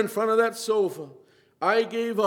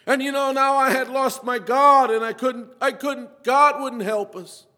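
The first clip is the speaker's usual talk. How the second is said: louder, impassioned testimony pressing every line; fervent preaching; 5 semitones higher, 6 dB louder than usual. Real, not acted. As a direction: preach it out like a revival sermon. Preaching testimony at full force.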